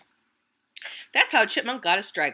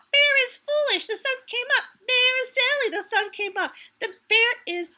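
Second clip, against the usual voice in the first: much higher, loud taunting chant; teasing; 4 dB louder than usual. Acted. Squirrel taunts Bear in a high singsong. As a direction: taunt him like a cheeky kid. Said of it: "began to tease Bear".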